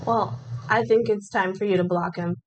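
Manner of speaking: ordinary delivery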